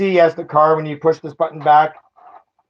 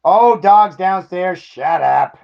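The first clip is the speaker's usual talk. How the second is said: normal talking voice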